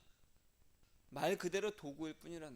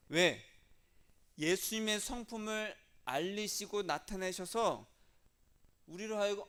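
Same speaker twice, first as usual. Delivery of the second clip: emphatic preaching; 4 semitones higher, 8 dB louder, slower than usual. Real, not acted.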